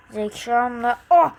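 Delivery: ordinary talk, nothing marked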